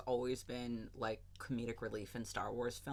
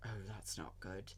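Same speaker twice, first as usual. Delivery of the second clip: lower, much softer, bone-dry understatement; driest tone; 5 semitones lower, 10 dB quieter than usual. Retold or imitated. Obi-Wan about to fall off the roof, bone-dry. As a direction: toss it off as if nothing is wrong. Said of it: "but, like, the driest tone".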